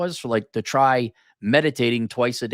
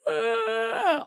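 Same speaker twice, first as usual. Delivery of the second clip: high whiny mock sob; mock crying; 4 dB quieter than usual. Man mimicking his crying girlfriend, mock crying. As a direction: imitate a wailing cry, high-pitched.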